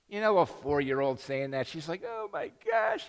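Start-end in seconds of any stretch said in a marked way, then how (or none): 2.1-3.1 much higher, slow gush; mock swooning clerk. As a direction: clerk melting over the child, high gushing squeal. Clerk gushes at the boy.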